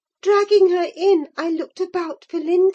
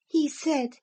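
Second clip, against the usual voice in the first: plain reading voice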